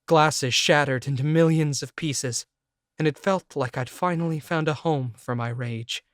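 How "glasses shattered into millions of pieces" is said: ordinary delivery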